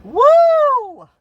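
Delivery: shrieking woo of laughter; high loud whoop. Real, not acted. After retelling the boss, a high loud whooping laugh. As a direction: let out a high screaming laugh.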